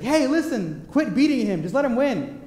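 put-on a parent telling off the older brother, half-yelled; parents yelling. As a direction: call out to stop the fighting. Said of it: "they would yell at my brother".

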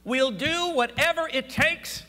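loud, ringing pulpit build; preaching at full force. Acted. Preacher exhorting the congregation, loud and rising. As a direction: lift the church with it, full voice.

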